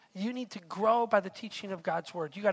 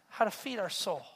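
ordinary delivery

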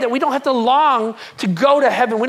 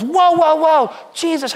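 loud, higher "Whoa, whoa" hail; calling out; 6 semitones higher, 5 dB louder, slower than usual. Retold or imitated. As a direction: flag him down, running after him. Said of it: "this dude comes running and goes".

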